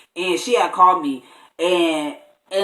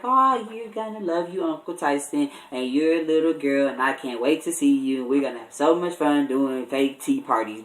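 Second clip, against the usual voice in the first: soft, lower and hushed, cooing to the unborn niece; tender belly talk; 3 semitones lower, 7 dB quieter than usual. Retold or imitated. Uncle talking softly to his sister's unborn baby, tender. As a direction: murmur sweetly to the baby inside.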